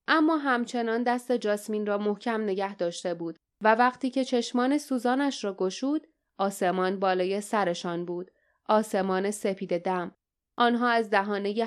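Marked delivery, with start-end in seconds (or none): none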